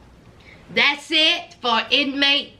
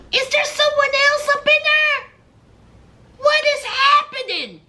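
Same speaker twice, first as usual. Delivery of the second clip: alarmed question; 10 semitones higher than usual. Retold or imitated. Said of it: "she's like".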